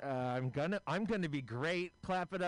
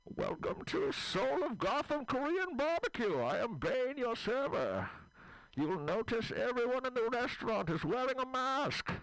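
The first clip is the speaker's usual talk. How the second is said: higher, stiff and formal put-on villain voice; a movie villain politely greeting diners; hammed-up comic Bane, pitched up; Bane the server; 7 semitones higher than usual. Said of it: "He's like".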